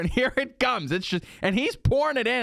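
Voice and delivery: high-pitched, incredulous storytelling; exasperated alarm. Retold or imitated. Diner re-enacting watching his beer overflow, exasperated alarm. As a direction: voice leaps up in comic dismay.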